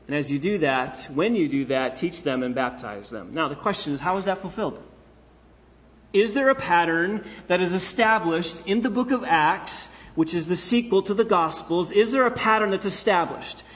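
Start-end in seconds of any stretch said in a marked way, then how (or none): none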